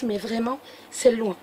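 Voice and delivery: ordinary delivery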